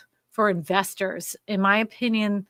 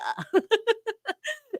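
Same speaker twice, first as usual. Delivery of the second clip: high laugh; 11 semitones higher than usual. Real, not acted. Laughs after infomercial bit.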